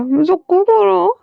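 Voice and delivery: high, tearful crying plea. Acted. Wing pleads with Wang Ji while being force-fed, crying. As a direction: sob it out as he's force-fed. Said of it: "रोते हुए कहता".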